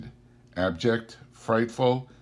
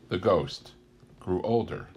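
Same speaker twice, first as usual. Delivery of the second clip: ordinary delivery, nothing marked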